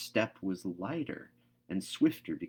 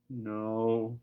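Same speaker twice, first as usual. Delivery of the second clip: plain reading voice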